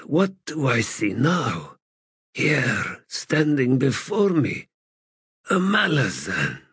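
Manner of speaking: lightly voiced, weary, bitter god; a hint of a broken ancient god; frail god voice. Crippled god continues.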